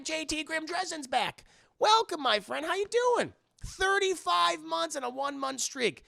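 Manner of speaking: high, announcer-like hearty greeting; excited welcome. Real, not acted. Streamer greets a long-absent friend, high and excited. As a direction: welcome them back with pitch held high.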